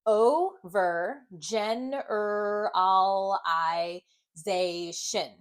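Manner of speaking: very slow, syllable by syllable "Overgeneralization"; sounding out slowly. Shown as a demonstration. Teacher sounding the word out for the class, very slowly. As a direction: stretch out every syllable. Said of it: "sound this word out together".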